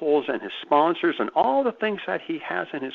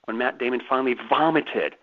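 normal talking voice

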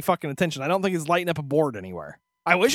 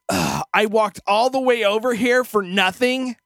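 slow, louder, higher put-upon whine; whiny complaint; 4 semitones higher, 5 dB louder, slower than usual. Retold or imitated. Casino worker complaining about the wasted walk, drawn-out whine. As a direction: groan it out like an annoyed worker. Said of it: "And the other guy goes".

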